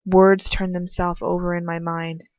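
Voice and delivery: plain reading voice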